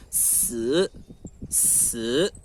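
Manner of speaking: Si descendente e baixo; fourth tone si. Shown as a demonstration. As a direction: diga si no quarto tom, descendo. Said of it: "quarta entonação". (si no quarto tom)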